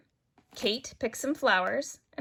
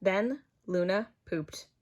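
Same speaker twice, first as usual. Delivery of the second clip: ordinary delivery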